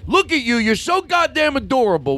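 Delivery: high, forceful gushing; aggressive love. Pretend. Host tells listeners they are adorable, aggressively loving. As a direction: throw the compliment at them hard. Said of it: "throw love at them aggressively".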